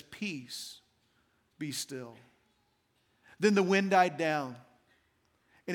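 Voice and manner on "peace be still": low and near-whispered, calm and firm; hushed command. A narrator's reading. Jesus calming the sea, low soft firm command. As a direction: speak peace over the waves softly. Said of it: "Or maybe you know this as".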